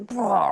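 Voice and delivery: high, loud cartoon "Blah"; mock bear growl. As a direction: blurt a silly monster noise. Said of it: "and it's like".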